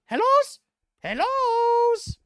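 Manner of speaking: very high, loud, drawn-out goblin call. Acted. Goblin calling through secret door.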